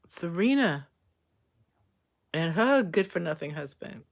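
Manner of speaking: normal talking voice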